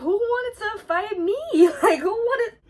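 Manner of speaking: high, loud, incredulous squeal; high loud incredulous retelling; shocked disbelief. Retold or imitated. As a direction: act out total disbelief at the news. Shocked retelling of fight threat.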